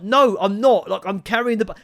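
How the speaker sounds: high, loud protest; worked-up comic indignation; exasperated retort. Acted. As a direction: argue back, fed up and cut short. Arguing with the watch.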